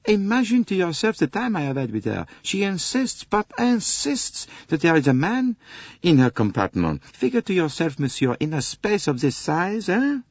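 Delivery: high, exasperated French conductor; a harried Frenchman pouring out his troubles. Acted. Conductor complains to Poirot about the American lady, exasperated.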